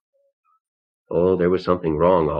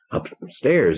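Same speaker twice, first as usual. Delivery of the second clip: stammering; 6 semitones higher than usual. Acted. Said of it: "he stammered".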